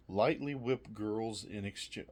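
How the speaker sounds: normal talking voice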